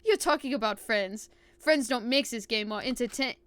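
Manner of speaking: put-on scoffing in a German accent. Villain scoffing at talk of friends, German accent. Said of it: "yell all that shit out with a German accent".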